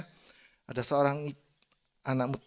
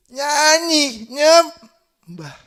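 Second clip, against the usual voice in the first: high, loud, drawn-out preacher's call; rally the congregation at full voice; belted pulpit command, words stretched; rousing exhortation; 12 semitones higher, 13 dB louder, much slower than usual. Real, not acted. Preacher urging congregation to sing and worship.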